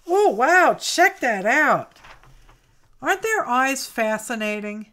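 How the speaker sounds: loud, high burst of delight; excited. Real, not acted. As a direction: gasp it out, thrilled. Thrilled by a picture.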